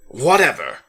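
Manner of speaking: loud growling dismissal; growled. A narrator's reading. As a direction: throw the word away in frustration. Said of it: "he growled".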